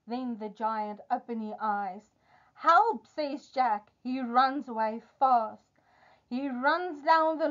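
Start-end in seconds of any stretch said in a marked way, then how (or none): 2.5-3.1 short, high cry for help; alarmed cry. Acted. Jack flees as the giant wakes, alarmed. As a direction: yelp it in storybook alarm.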